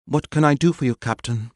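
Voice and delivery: high, clipped politeness; polite but cold; curt. Acted. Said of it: "she added".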